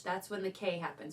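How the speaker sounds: ordinary talk, nothing marked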